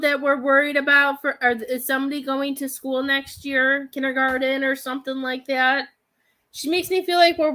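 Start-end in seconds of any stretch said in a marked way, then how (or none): none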